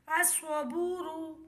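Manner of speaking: الصبور said slowly as "as-sabur", lam silent. Shown as a demonstration. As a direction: draw out الصبور, saad doubled. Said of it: "لام خاموش رہے گا".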